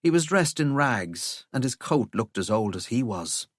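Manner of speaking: plain reading voice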